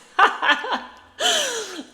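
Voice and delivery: high, loud laugh; helpless high-pitched cackle; real burst of laughter. Real, not acted. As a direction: burst out laughing at your own remark. Cracks up at own remark.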